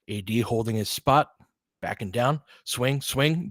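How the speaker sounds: running game call, steady and brisk; live play-by-play. Acted. Streaming the Lakers game to his viewers, live play-by-play. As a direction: call each possession as it happens.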